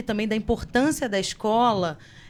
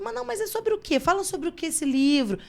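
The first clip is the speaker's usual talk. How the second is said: a light high curious asking; mildly voicing her curious question; 7 semitones higher than usual. Teacher asking students about their book, lightly high and curious. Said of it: "eu sempre pergunto".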